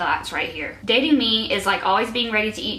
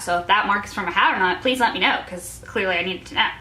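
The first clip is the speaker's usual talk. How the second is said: normal talking voice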